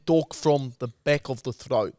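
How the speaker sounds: throaty attempt at Russian accent. Shown as a demonstration. Host teaching co-host, throaty Russian accent. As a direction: demonstrate Russian from the back of the throat. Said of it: "you go a bit nasally".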